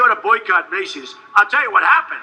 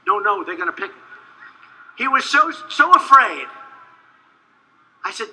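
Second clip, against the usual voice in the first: ordinary delivery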